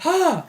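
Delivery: short high "ha" yelp; mock action-hero burst; imitated hero cry.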